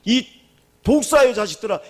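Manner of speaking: loud, high rebuke on 독사의; preacher reading the rebuke, loud and high. Retold or imitated. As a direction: cry it out sternly. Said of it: "마태복음 십이 장".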